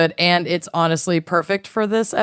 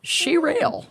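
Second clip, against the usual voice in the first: high squeaky voice; 11 semitones higher, 3 dB quieter than usual. Pretend. Echoed in high voice.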